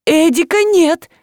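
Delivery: high-pitched, slow exclamation; restrained high yell; crying out. A narrator's reading. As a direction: cry out in alarm, not full volume. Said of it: "закричала Галя".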